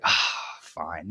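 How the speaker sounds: low, soft, reluctant giving in; imitating a deflated, reluctant agreement; resigned sigh. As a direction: let out a sigh and quietly give in.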